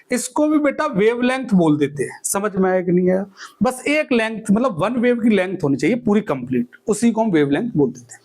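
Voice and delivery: ordinary delivery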